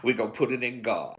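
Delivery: a hint of a resigned churchgoer, voiced low and quiet; mocking resignation. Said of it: "These Negroes like".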